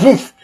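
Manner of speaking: high, loud throwing noise; imitated whoosh. Girl heaving the apple upward, imitated whoosh. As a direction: mimic something flung hard.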